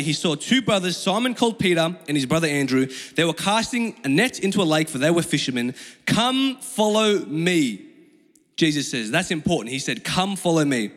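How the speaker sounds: normal talking voice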